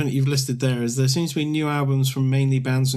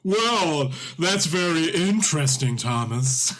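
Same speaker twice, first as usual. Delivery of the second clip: stilted, higher hammy line reading; bad acting; 5 semitones higher, slower than usual. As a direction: deliver it like a terrible actor. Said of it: "You're gonna sound like a really bad actor in it".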